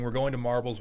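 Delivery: normal talking voice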